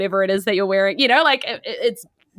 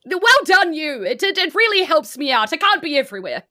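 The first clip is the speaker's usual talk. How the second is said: put-on higher, warm praising old man; jolly Santa; 8 semitones higher, 4 dB louder than usual. GM voices Santa praising cleric.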